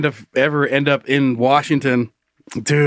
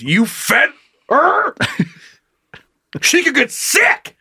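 angry; 11 semitones higher, much slower than usual. Retold or imitated. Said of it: "The man angrily says".

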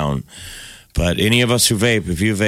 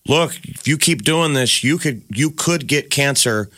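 slow, higher, earnest warning; 8 semitones higher, slower than usual. Retold or imitated. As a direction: plead with smokers slowly. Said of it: "we were saying".

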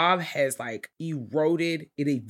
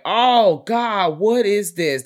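louder, higher horrified gasp; shocked exclamation; 6 semitones higher, 8 dB louder, much slower than usual. Retold or imitated. Retelling the crowd seeing the body, mock shocked outcry. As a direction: recoil at the gross sight. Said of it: "everyone is like".

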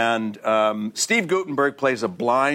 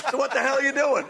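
exasperated director; 13 semitones higher, faster than usual. Retold or imitated. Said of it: "the director's outburst".

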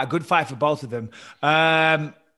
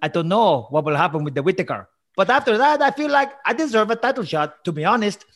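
put-on higher, hammy Italian accent; 4 semitones higher than usual. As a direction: keep up the Italian fighter voice. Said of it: "I'll do it as Marvin Vettori".

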